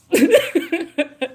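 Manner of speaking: short high-pitched laugh; a bright giggle. Real, not acted. Vlogger giggling after stepping off her soapbox.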